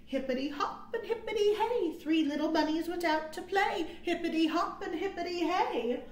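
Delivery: usual speaking voice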